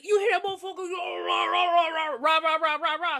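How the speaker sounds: high-pitched, hammed-up ranting; mock angry rant. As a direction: imitate a furious tirade dissolving into rah-rah noise. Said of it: "So he pissed, you know, he, he mad".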